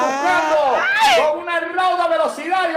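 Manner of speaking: high, loud, stretched commentary; excited play-by-play. Real, not acted. Commentator following a quick attack, loud excited call. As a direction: call the fast break at full voice.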